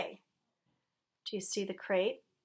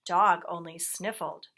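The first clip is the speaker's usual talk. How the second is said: ordinary delivery, nothing marked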